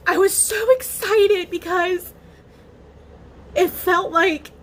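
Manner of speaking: high, breaking voice through real tears; telling her viewers about the new treatment, crying happy tears. Real, not acted. Real happy sobbing, voice cracking high. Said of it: "I started crying happy tears".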